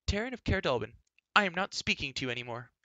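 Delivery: high indignant declaration, no real sobs; tearful huff. A narrator's reading. Girl stamping her foot at Taryn, upset and indignant. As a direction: announce it hotly, starting high. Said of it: "Sobbing, Elenwy stamped her foot".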